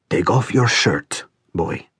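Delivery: put-on slow old man's order, softening at the end; old man commanding. A narrator's reading. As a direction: give the order slowly, in an aged voice.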